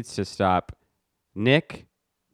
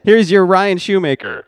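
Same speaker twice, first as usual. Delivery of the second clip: announcer voice; 9 semitones higher, 9 dB louder than usual. Shown as a demonstration. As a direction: present the segment like a host. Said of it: "I like this one".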